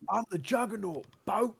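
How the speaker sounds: loud high shouted movie villain; Juggernaut voice. Retold or imitated. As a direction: bellow it like a hammy Juggernaut. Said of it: "impression of the worst Vinnie Jones".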